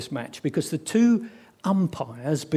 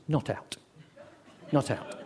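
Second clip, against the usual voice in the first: softer, understated umpire's ruling; unmoved umpire refusal; 3 semitones lower, 8 dB quieter than usual. Acted. Umpire refusing the bowler's appeal, quietly unmoved.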